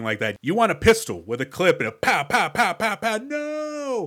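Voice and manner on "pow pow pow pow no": quick-fire pows into a high, drawn-out no; rapid gunfire imitation. Shown as a demonstration. Showing how a pistol suits the drama, rapid mock gunfire. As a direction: mimic a pistol emptying, then a mock wail. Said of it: "But this one's like".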